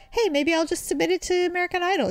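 quick, high, naive eagerness; retold bright-idea voice; hopeful thought. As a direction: pitch it up, rushing with hope. Retelling her naive hopeful thought.

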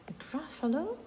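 high, surprised question; surprised owl. A narrator's reading. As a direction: owl taken aback by the strange word. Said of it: "Сова, звичайно, також здивована і каже". (здивована сова)

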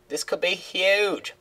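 dreamy woman's voice; Simone's voice. Acted. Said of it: "her voice is hard to do".

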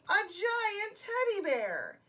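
high, slow, loud wonder; amazed reveal. A narrator's reading. Boy finds giant teddy, amazed. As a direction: draw it out in big amazement.

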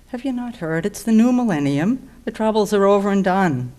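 acted exasperated, high and loud; incredulous outburst. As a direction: raise your voice as if he's missed obvious news. Liam scoffs at McKeon's fear.

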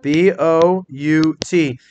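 B O U T, each letter spelled out slowly; slow spelling. Shown as a demonstration. Teacher spelling B O U T slowly for students. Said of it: "A split".

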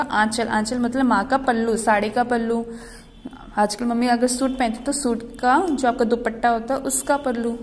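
ordinary delivery

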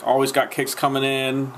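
usual speaking voice

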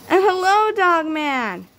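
high, cheerful greeting; bright high hello. Acted. Nurse Lady greets Dog Man.

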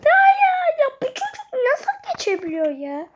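high, loud astonished shout; can't believe what he sees; amazed shout. Real, not acted. Gamer watching a rival pass, astonished high shout.

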